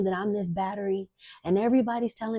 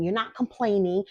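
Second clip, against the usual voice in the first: ordinary delivery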